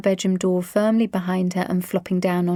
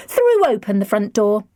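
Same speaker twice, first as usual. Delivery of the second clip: ordinary delivery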